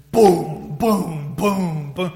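loud, punchy mimicked car-stereo thumps; heavy imitated bass beat; booming bass imitation. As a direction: boom it out like a subwoofer.

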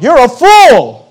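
loud, high exclaimed insult; mock rebuke. Preacher playfully naming foolish people, loud mock rebuke. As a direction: hurl it as a mock verdict.

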